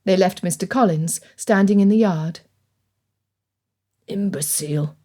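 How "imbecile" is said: low, soft muttered insult under the breath. A narrator's reading. Father mutters about his cousin as they leave, soft scornful mutter. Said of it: "Mister Bennet muttered".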